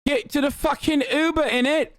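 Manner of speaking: high, exaggerated British chav voice; mock British accent. Streamer imitating British chat demanding Uber story, mock British accent. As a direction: pester in a hammed-up British accent. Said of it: "every British person in the chat's like".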